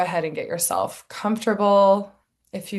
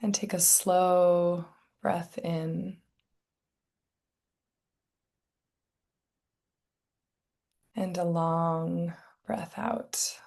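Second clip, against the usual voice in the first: guided meditation; 7 dB quieter than usual. Real, not acted. Meditation teacher settling listeners into their bodies, soft guided meditation. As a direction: ease them gently into stillness, softly and unhurried.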